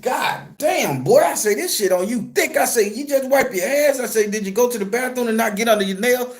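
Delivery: high, disgusted disbelief; shocked. Retold or imitated. To his brother about the foul-smelling hands, high shocked disgust. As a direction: recoil at the stench in a high voice.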